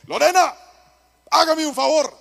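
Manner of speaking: husband asserts himself to his wife, commanding; man of the house. Retold or imitated. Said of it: "Y le dije".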